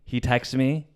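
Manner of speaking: usual speaking voice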